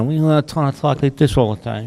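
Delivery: lightly voiced Brando; Brando's natural talk. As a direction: mumble it like Brando breaking the old style. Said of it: "he's the first one to kinda".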